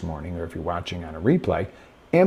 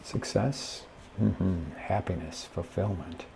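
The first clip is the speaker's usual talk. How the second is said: unenthused; 10 dB quieter, slower than usual. Shown as a demonstration. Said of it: "You might be going".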